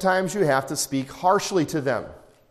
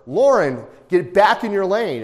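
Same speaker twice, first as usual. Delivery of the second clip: loud, higher warning yell; yelling at daughter; 6 semitones higher, 6 dB louder than usual. Retold or imitated. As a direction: snap her back into her lane. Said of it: "I would yell at her".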